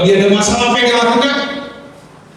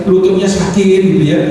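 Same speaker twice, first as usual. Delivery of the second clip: ordinary talk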